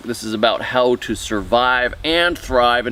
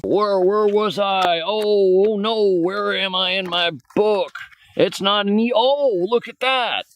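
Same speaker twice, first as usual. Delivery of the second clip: mock panic; 6 semitones higher than usual. Mock panic over losing his place.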